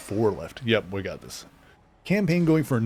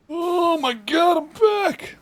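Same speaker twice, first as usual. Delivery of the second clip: high, loud, thrilled shout; excited shout; 5 dB louder, slower than usual. Real, not acted. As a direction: yell it in relief. Triumphant return in game.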